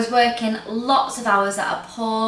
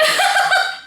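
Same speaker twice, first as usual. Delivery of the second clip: high breathy laugh; 4 dB louder than usual. Real, not acted. Laughing at own story.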